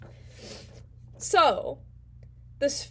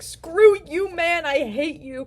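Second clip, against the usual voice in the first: higher, louder outburst at the sphere; angry yell; 5 semitones higher, 6 dB louder, slower than usual. Retold or imitated. The square yells in anger at the sphere. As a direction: snap back at him furiously.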